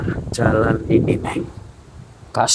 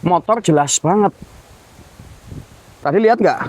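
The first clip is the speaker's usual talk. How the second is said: higher, urgent and rising; alarmed disbelief; 10 semitones higher, faster than usual. Real, not acted. Ghost hunter to his companion, rising alarmed disbelief. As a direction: press your friend in shock. Insisting the motorbike vanished.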